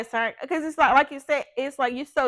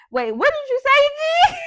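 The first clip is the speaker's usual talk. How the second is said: much higher, loud, incredulous; quick high-pitched comeback; 11 dB louder than usual. Retold or imitated. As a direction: shoot it back high and loud. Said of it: "I'm quick to be like".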